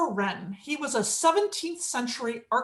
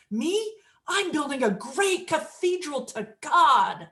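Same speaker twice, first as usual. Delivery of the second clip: full of wonder; 2 semitones higher, slower than usual. Acted. Third bricklayer tells the architect his work, full of wonder. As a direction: savour it slowly with proud wonder. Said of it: "his face lit up with wonder".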